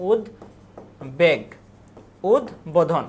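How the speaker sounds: উদ্বোধন as "ud... bodhon", with a clear gap; sounded ব-ফলা. Shown as a demonstration. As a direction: say উদ্বেগ very slowly as "ud... beg". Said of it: "লুপ্ত হয় না".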